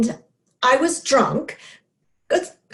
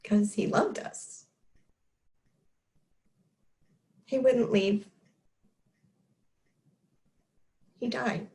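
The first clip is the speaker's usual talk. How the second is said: soft, halting admission with long pauses; subdued grief; 7 dB quieter than usual. Acted. Speaker admitting to listeners that father died, quietly grieving. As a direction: let each short sentence fall quietly.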